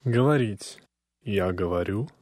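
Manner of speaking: ordinary talk, nothing marked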